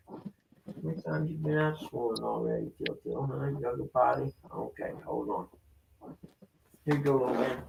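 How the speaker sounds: soft, coaxing voice for pets; talking to dogs. Real, not acted. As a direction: coax the dogs gently.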